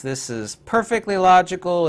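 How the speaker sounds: ordinary talk, nothing marked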